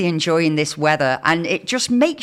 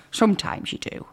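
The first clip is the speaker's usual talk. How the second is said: whispered "Sometimes you do" with no voice; 3 semitones higher, 5 dB quieter than usual. Shown as a demonstration. Host whispers "Sometimes you do" as a confiding aside. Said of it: "repeated whispered".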